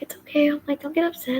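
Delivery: high, sweet comforting tone; soothing mom. Retold or imitated. Mom calming her upset daughter, high and sweet. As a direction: coax a child out of tears, gently.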